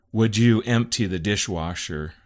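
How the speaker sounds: loud, pointed demand; demanding reading. Shown as a demonstration. Husband misreading wife's text, loud and demanding. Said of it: "I read it as".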